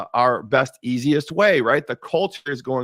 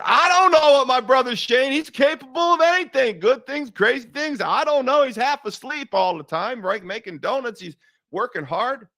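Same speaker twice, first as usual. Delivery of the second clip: high and loud, words tumbling out in panic; frantic rambling; 11 semitones higher, 3 dB louder than usual. Retold or imitated. Answering Chad about his brother Shane, flustered and much higher. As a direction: spill it all out in a flustered rush.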